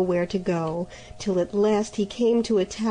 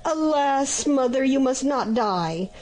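cried; 5 semitones higher than usual. Acted. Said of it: "cried the good Vatasco".

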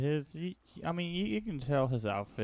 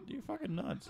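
high-pitched quote; 12 semitones higher, 4 dB quieter than usual. Retold or imitated. Quotes own reaction to crystal skull.

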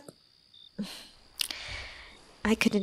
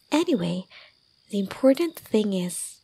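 ordinary talk

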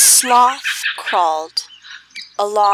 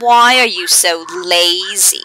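jaguar voice; 3 semitones lower, 5 dB louder than usual. Acted. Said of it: "said the jaguar".

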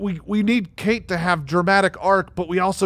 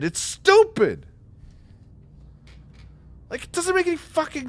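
exasperated rant; 6 semitones higher, 4 dB louder than usual. Real, not acted. Ranting at the game's plot.

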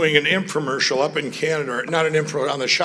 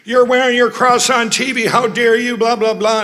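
higher, louder indignant callers; mock angry callers; 8 semitones higher, 7 dB louder than usual. Angry late-night callers scolding him, higher and louder. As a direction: scold him like an offended viewer. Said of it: "calls by people going".

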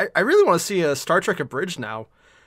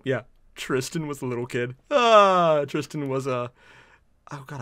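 ordinary talk

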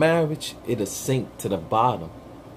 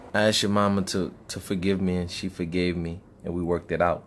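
lower, softer real voice; own voice; 6 semitones lower, 6 dB quieter than usual. Real, not acted. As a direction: drop the character, talk normally. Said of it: "can I get back to my character voice now".

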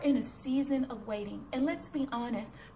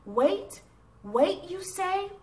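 questioning disbelief, rising on each "wait"; indignant echo, broken by pauses; 6 semitones higher, 3 dB louder, much slower than usual. Acted. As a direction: throw it back as an affronted question. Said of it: "My indignant reply".

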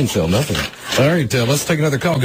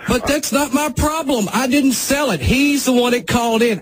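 heated defensive yell; 12 semitones higher than usual. Acted. Host arguing with the angry wife on air, higher heated yell. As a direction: shout him down and refuse blame.

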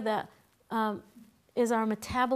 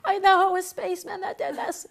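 higher, louder mock paranoid; spooked Vata; 8 semitones higher, 5 dB louder than usual. Vata blaming spacemen for the breakdown, mock freaked out. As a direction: sell the spacemen theory wide-eyed. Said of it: "they're gonna go".

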